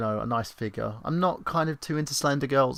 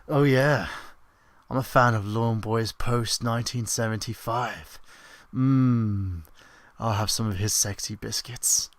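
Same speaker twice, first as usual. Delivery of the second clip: creepy voice. Shown as a demonstration. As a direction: lay on the creep again. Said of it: "Do you want me to do more?".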